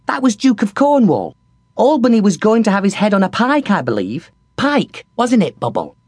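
light and eager, giving news; young kitchen girl Squeak. A narrator's reading. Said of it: "corrected Squeak".

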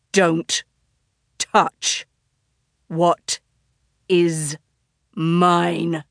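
menacing wolf. Acted. Wolf threatens fox, pinned down.

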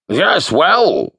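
put-on theatrical booming old wizard. A narrator's reading. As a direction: boom it out like a grand wizard. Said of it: "said the wizard in a booming voice".

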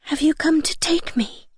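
put-on high, whispery timid girl; gentle, trembling. A narrator's reading. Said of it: "in a gentle, trembling voice".

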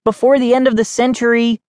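impatient, quick and high; called out. A narrator's reading. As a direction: rattle it off as a nagging call. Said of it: "I called out".

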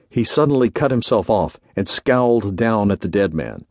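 plain reading voice

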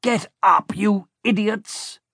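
villain yelling at his clumsy crew; villain shouting. A narrator's reading. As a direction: bark at the fallen henchmen. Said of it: "the Baron shouted".

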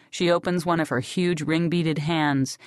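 plain reading voice

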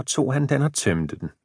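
plain reading voice

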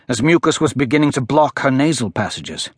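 ordinary delivery

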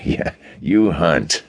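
laughing through the words, slow; mock him while still laughing; laughing mockery. A narrator's reading. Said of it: "mirth that rocked him".

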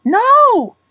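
very high, loud cry of no; sudden high-pitched horrified cry; shouted protest. A narrator's reading. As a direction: shout out to stop it. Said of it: "shouted Anne".

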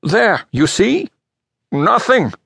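high, drawn-out vindication; pompous expert clinching his case; emphatic proof. Acted. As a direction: show them you were right. Expert proves the page fake.

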